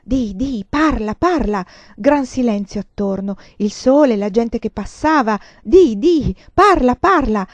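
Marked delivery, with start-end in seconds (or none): 0.0-1.7 loud, pressing urging; voiced with rising force; insistent repeated demand. A narrator's reading. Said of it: "ripeté". 5.6-7.5 loud, pressing urging; voiced with rising force; insistent repeated demand. A narrator's reading. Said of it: "ripeté".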